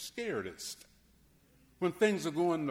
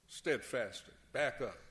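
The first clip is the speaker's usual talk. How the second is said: calm God; 6 semitones lower, 4 dB quieter than usual. Retold or imitated. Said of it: "When God says".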